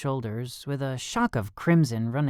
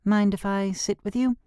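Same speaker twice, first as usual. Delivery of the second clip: put-on girl voice; 8 semitones higher than usual. A narrator's reading. Girl's voice.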